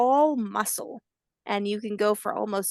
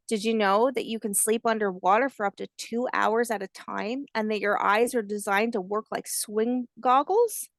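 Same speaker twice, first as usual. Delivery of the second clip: ordinary delivery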